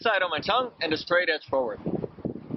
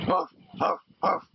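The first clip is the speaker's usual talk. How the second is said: dog huffs; slower than usual. Shown as a demonstration. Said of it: "with the dog, I just say".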